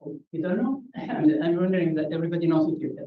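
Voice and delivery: ordinary talk, nothing marked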